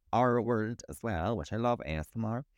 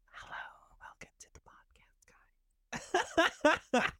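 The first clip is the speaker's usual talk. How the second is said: ASMR whisper; faster than usual. Shown as a demonstration. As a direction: whisper it like an ASMR podcast intro. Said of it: "we should do an A S M R podcast".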